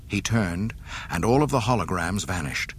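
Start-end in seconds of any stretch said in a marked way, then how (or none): none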